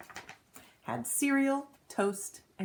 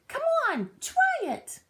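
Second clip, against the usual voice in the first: excited call; 4 dB louder than usual. A narrator's reading. Calling friend to join.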